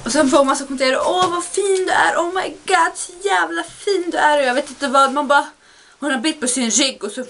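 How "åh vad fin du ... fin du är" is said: higher sing-song fake adoration; lightly hammed-up gushing compliments; mock gushing commenter. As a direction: coo like a fake fan.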